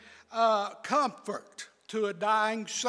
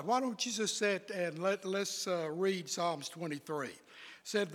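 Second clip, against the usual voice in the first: normal talking voice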